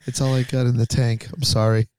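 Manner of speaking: ordinary talk, nothing marked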